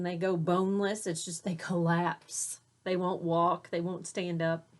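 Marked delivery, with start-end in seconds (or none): none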